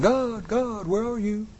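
high searching call. Acted. Preacher acting Adam calling for God, high call.

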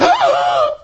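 a loud, high gasp of fright; shocked gasp. Acted. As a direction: gasp in horror at the hand. Hand turns leprous.

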